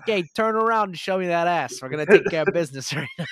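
hammed-up creepy rubdown guy, pushy; sleazy masseur. Old masseur leering at the quarterback, loud mock voice. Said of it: "He's like".

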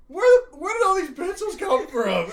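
high, loud, slow baffled teacher; baffled shout. Retold or imitated. Art teacher finding pencils in his pocket, shouting in confusion.

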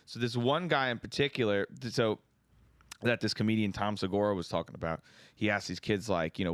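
ordinary delivery

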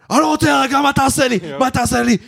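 high, mock-aggressive taunt; imitating a protester spoiling for a fight; aggressive man. Aggressive man retorts, quoted.

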